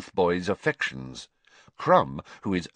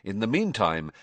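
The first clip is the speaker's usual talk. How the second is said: plain reading voice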